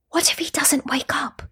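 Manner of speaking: high, hushed whisper; worried whisper. A narrator's reading. Villager whispers to another over the unmoving boy, worried whisper. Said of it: "one villager whispered to another".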